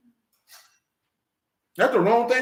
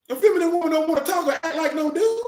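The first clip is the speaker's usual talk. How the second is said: normal talking voice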